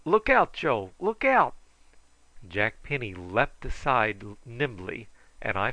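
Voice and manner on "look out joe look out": loud, high cry of alarm; urgent warning shout. A narrator's reading. Jack warning Joe of sudden danger, shouting urgently. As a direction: break in with a sharp, high warning.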